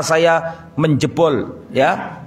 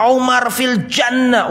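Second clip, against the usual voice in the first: higher, slow, ringing call; proclaiming; 9 semitones higher, slower than usual. Retold or imitated. Preacher voicing the Prophet's promise to Umar, ringing call. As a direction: announce it like good news.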